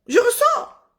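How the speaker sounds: RESSORS pitched very high; emphatic repeat. Real, not acted. Vlogger telling viewers she leaves a dull film, high emphatic repeat.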